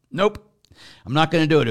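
high, loud flat refusal; defiant refusal. Retold or imitated. New pastor refusing the vicar general's order, loud and defiant. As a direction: dig in and refuse outright.

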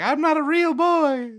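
high-pitched mock puppet boy; Pino impression. Mocking a movie robot boy to co-host, high put-on voice. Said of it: "Yeah, it's like".

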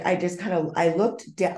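ordinary delivery

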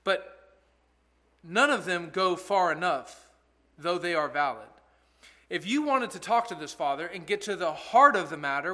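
ordinary talk

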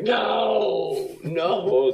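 high yelped no, no; shocked no. Retold or imitated. Friend hearing the movie news, yelped high disbelief.

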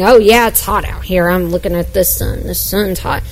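whiner voice. Acted. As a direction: moan about the heat like a chronic whiner. Sermon acting out a whiner dialogue.

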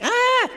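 short high, loud scream; hammed-up yelp of alarm; mock panicked scream. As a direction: shriek as if fleeing a fight. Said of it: "Some of you don't like conflict".

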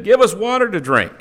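normal talking voice

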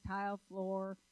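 usual speaking voice

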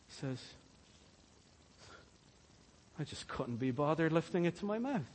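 lazy sluggard. Acted. Sluggard at the dinner plate, too lazy to eat, soft and limp. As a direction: moan like you can't be bothered to move.

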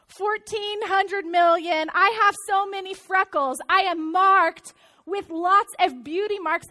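ordinary talk, nothing marked

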